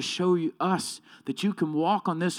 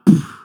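loud dismissive lip blast; scornful pfft; 13 dB louder than usual. Retold or imitated. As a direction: spit out a contemptuous pfft. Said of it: "And I'm like".

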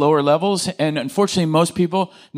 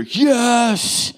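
a cheer; 7 semitones higher than usual. Retold or imitated. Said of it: "I gave, like, a cheer".

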